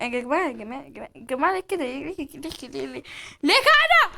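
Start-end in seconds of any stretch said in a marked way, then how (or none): 3.4-4.1 high, loud demand; shouted protest. Real, not acted. Player asking everyone why do this, shouted protest. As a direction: burst out in a high-pitched shout.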